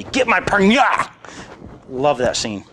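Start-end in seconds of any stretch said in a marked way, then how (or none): 0.0-1.1 impatient demand. Retold or imitated. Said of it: "And he says".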